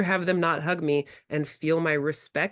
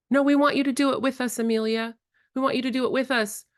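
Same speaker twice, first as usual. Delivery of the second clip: put-on kids begging; 7 semitones higher, faster than usual. Kids' voices begging Amelia.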